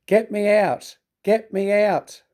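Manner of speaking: high, pleading cry; desperate plea. A narrator's reading. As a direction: beg to be freed. Gordon stuck in mud.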